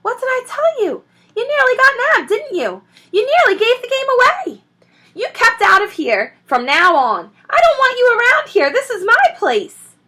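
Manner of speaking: very high, loud scolding rat; shrieking Rat. A narrator's reading. Rat scolds the foxes from his shelf, shrill high shriek. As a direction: screech at the intruders. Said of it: "Rat stood on his high shelf and shrieked".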